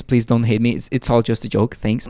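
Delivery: ordinary delivery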